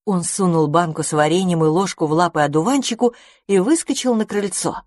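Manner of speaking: ordinary delivery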